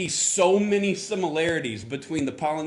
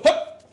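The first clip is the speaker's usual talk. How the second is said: sharp, high, loud hup like a hike call; 6 dB louder than usual. Retold or imitated. Imitating his dad warning him, sharp high loud hup. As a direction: bark a short hup much higher and louder. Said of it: "He would go".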